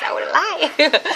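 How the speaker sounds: giggling denial; laughing, high. Real, not acted. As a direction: laugh through the promise. Laughing while insisting to viewers.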